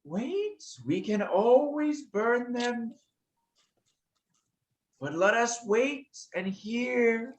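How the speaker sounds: high, slow, measured dragon counsel; high-pitched dragon voice. Acted. The second dragon counsels patience to the larger one, high and slow. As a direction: lift the pitch and draw out the phrases. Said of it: "the high-pitched voice say".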